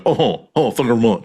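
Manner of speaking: [name] impersonator. Retold or imitated. Mocking how impersonators replaced [name], light [name] voice. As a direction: toss off a quick [name] impersonation. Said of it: "every single [name] impersonator going".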